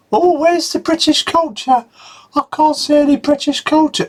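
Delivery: high, whiny mock complaint; mock whiny tourist. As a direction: squeak it like a moaning visitor.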